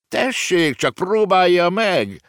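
high, slow mocking challenge; defiant dare. A narrator's reading. Wallenstein daring Hannibal, high mocking voice. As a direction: dare him to try.

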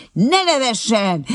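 slow, loud, high cry; beside herself, shouting. A narrator's reading. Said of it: "kiáltott magán kívül".

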